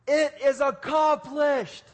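slow, high, loud triumphant cry; war cry. Acted. Jesus crying out from the cross, slow, high and loud.

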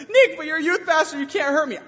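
put-on high, loud, laughing kid voice; taunting student. Student taunts youth pastor Nick, high and loud, laughing.

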